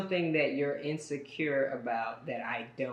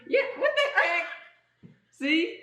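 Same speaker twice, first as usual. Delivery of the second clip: much higher, slow, laughing; playful mock offense; laughing protest; 12 semitones higher, much slower than usual. Real, not acted. Defensive joking reply.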